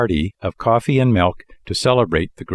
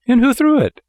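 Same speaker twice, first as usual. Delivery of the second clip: high anxious puppet voice; 4 dB louder than usual. A narrator's reading. Pinocchio anxious question.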